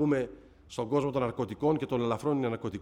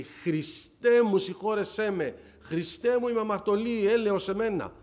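retold cry for mercy, pitched higher and louder; crying out to God; 6 semitones higher, 4 dB louder, slower than usual. Mother on her knees begging Christ for mercy, crying out loudly. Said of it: "αλλάζει το στόμα της και λέει".

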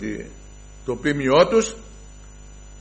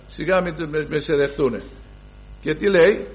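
ordinary talk

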